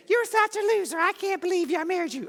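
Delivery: high, mocking put-on voice of a spouse; weird mocking voice. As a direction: mimic your spouse with a sneering high voice. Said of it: "They may have said it with passion".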